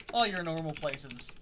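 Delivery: speaking through hiccups; hiccuping mid-phrase. Real, not acted. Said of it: "got the hiccups".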